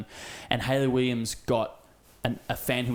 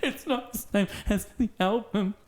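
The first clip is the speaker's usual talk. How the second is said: put-on high, whiny child complaining; whiny kid; 10 semitones higher than usual. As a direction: whine like a let-down kid. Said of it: "What a dumb kid".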